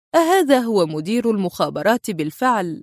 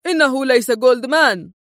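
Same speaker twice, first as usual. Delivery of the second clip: sharp yell; 4 semitones higher than usual. Acted. Ford exposes the fake Goldman, shouting. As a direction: shout the warning at the others. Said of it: "وفي حدة صرخ فورد".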